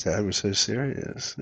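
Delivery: normal talking voice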